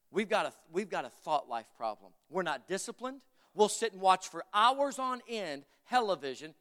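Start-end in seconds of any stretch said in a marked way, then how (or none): 5.9-6.5 "television" said as "hellevision"; "television" turned into the pun "hell-evision"; deliberate mispronunciation. Real, not acted. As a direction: swap the first sound of "television" for an H, so it starts with "hell". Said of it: "I like saying it that way".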